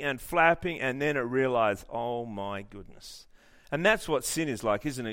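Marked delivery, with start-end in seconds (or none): 1.9-3.3 slow, low and hushed; dawning dismay. Acted. Preacher voicing the eagle as it realises it's stuck, in quiet dismay. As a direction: let the awful truth sink in slowly.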